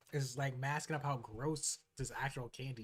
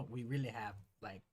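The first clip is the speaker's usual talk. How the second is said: mock candy maker; 4 semitones lower than usual. Mocking the candy makers' pitch.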